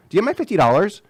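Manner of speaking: nagging ex voice. Retold or imitated. Ex calls again.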